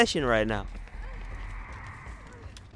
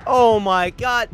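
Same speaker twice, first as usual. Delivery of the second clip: higher louder despairing shout; anguished yell; 10 semitones higher, 11 dB louder, much slower than usual. Real, not acted. Caught by killer.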